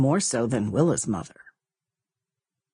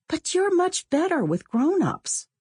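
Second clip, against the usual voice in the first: put-on higher girl's voice, wheedling; Willa coaxing; 13 semitones higher than usual. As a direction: flatter her into doing it. Girls arguing over who asks.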